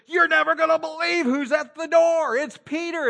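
high, breathless news burst from the door; excited announcement. Retold or imitated. Lady running back in to the praying group, high and excited. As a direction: blurt it out, high and thrilled.

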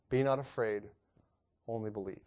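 Be not afraid, read low and hushed; a preacher reading scripture quietly; soft comforting read. Acted. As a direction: lay the words down gently, fading. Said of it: "he saith unto the ruler".

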